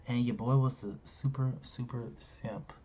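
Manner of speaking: sighing rueful. Real, not acted.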